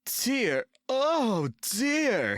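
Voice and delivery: high, loud yawning groan; sleepy engine yawning high and loud. A narrator's reading. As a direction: drag it out as if just waking. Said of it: "he yawned".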